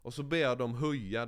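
ordinary talk, nothing marked

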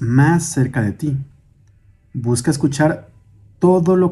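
calm, measured meditation guide with long pauses; guided meditation. Acted. Guide asking listeners to sit, breathe and hear their surroundings, guided meditation. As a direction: lead them gently through breathing and listening.

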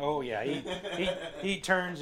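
ordinary delivery

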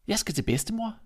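put-on an innocent girl chirping; little girl voice. Girl answers.

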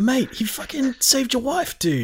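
high, incredulous outburst; exasperated outburst. Retold or imitated. Player protesting Deacon's threat, high and incredulous. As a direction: protest in high disbelief at the ingratitude. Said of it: "It's like".

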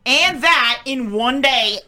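ordinary talk, nothing marked

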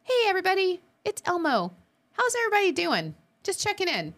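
high, bright puppet voice; lightly voiced Elmo greeting his fans. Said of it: "he said".

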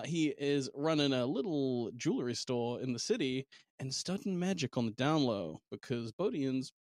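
normal talking voice

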